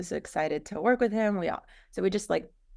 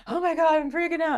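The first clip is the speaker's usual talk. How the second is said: high, louder panicked squeal imitating her partner; mock panic; 11 semitones higher, 4 dB louder than usual. Business partner panicking over problems, imitated in a high squeal. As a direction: play the partner freaking out, much higher. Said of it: "He's like".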